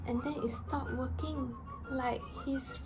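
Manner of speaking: hushed whisper. Real, not acted. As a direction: whisper it like a secret. Dramatic whisper of failure.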